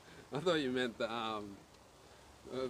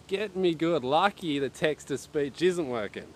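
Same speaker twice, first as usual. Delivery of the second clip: ordinary talk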